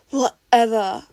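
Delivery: loud curt brush-off; gruff dismissal. A narrator's reading. Katsuki brushing off Izuku, gruff. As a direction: toss it away.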